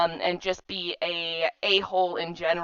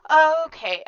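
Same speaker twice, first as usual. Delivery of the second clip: ordinary talk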